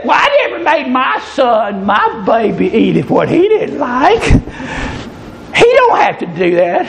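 mock old-lady fussing, swooping pitch; hammed-up old ladies doting and disapproving; indignant old ladies. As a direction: fuss like a scandalized old lady. Old ladies protesting in story.

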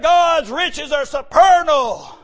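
full-voiced preacher building up; preaching. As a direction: preach it out to the congregation.

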